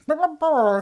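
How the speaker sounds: high playful burr burr noises; silly noise. Retold or imitated. To a laughing stepmom, goofy high burring noises. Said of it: "I'd be like".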